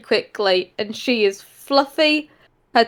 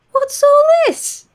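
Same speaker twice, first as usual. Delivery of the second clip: 1 delighted surprise; 4 dB louder, slower than usual. Acted. Mother delighted by tray.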